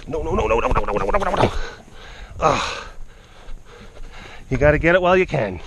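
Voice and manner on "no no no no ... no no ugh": usual speaking voice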